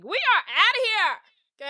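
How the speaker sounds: high, stretched-out announcement; big, drawn-out send-off; triumphant exit line. Retold or imitated. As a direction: sing out the getaway, slow and high. Marnie voiced announcing their escape.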